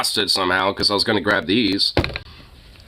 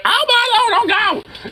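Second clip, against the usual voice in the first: much higher, louder mock panicked cry; imitating frightened lady; 3 dB louder, slower than usual. Retelling the old lady's fright at the dog, high mock panic. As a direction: play up her fright in a high, piercing voice. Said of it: "and went".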